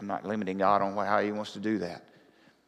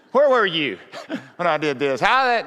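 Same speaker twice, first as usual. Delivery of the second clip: God calling Job out, laughing; 8 semitones higher, 10 dB louder than usual. Retold or imitated. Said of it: "That's the way I'd like to talk to people".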